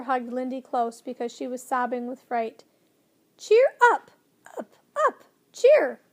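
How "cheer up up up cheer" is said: loud, high repeated squawks; bird squawk. A narrator's reading. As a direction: squawk it over the din.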